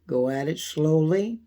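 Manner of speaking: ordinary talk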